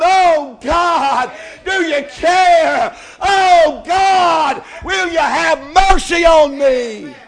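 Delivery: high, loud, drawn-out cry for mercy; preacher voicing a sinner crying at the altar; shouted sinner's plea. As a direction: cry out to God like a desperate sinner. Sinner's cry voiced at peak.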